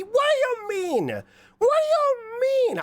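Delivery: very high, stretched-out squeal; mock squeal. Mock reply to "talk normally.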